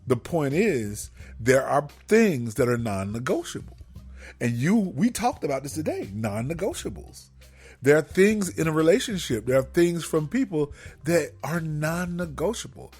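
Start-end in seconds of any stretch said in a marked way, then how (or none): none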